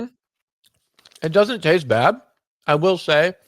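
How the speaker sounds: talking with a mouthful; words round a bite of food; mouth full. Real, not acted. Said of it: "still talking with your mouth full".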